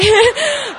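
a loud burst of laughter that falls away. Real, not acted. Retelling dad's emo text, a loud laugh. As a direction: break into a big laugh mid-story. Laughing while quoting dad.

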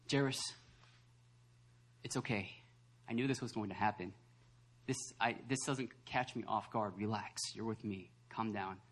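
low, hushed reassurance; calm someone down softly and unhurriedly; Jesus. Acted. Preacher as Jesus reassuring Jairus, soft and calm. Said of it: "It's almost as if Jesus saying".

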